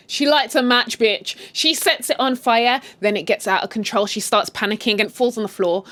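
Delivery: normal talking voice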